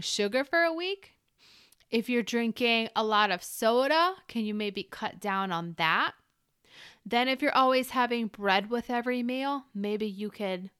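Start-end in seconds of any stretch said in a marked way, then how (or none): none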